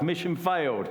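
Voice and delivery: real amused laughter; laughing. Real, not acted. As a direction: say it through a laugh. Punchline laughed out.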